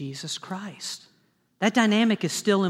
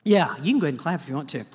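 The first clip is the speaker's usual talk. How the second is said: playful aside; 5 semitones lower, much faster than usual. Real, not acted. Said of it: "Guess what?".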